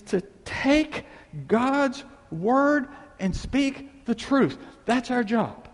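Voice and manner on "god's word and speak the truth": slow, higher, each word weighed; slow emphasis. Acted. Preacher telling the church their job, slowly. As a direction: spell out the message slowly.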